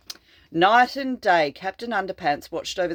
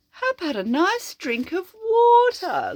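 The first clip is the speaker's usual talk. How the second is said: much higher, sing-song offer; a sly, sugary invitation; sweetly tricking; 12 semitones higher than usual. Acted. The hero luring the monster, sugary high voice.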